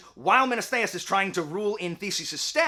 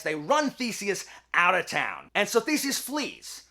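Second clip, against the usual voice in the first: plain reading voice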